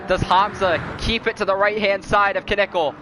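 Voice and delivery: steady race call, building; play-by-play commentary. Acted.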